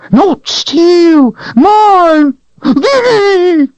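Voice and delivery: very high, loud shrieking claim; possessive creature cry. A narrator's reading. Creature shrieking at Richard that the sword is his. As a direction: shriek it like it's yours.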